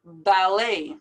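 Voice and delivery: "Ballet" as "bal-LAY", silent T; A-sound ending. Shown as a demonstration. Teacher matching it to buffet, AY ending. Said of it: "ending is just like this ending".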